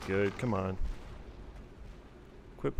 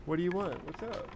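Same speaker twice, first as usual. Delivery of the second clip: much higher, soft cooing voice; 10 semitones higher, 7 dB quieter than usual. Real, not acted. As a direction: coo up high to the kitty. Baby-talking to crying cat.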